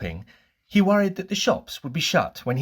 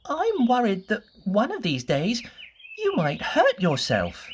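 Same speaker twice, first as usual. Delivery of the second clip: much higher, anxious fretting little voice; Mister Worry's voice; 9 semitones higher than usual. Acted. Mister Worry frets to Mister Bump in a high, anxious voice. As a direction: fret over him in a high, worried voice. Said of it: "he said".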